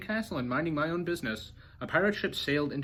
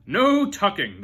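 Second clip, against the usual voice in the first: loud, higher chorus; crew crying out; 7 semitones higher, 10 dB louder, much slower than usual. A narrator's reading. Crew backs up Braidbeard, loud chorus. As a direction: cry it out together. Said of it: "the crew cried".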